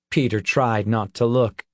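ordinary delivery, nothing marked

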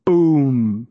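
loud explosive boom. A narrator's reading. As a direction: burst out the boom. Explosion cuts in.

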